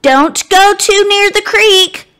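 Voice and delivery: high, loud motherly warning. Acted. Abe's mama Nancy warning the boys to stay back from the creek, high and firm. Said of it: "warns Abe's mama".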